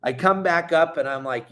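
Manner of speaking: ordinary talk, nothing marked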